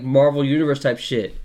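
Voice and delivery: ordinary talk, nothing marked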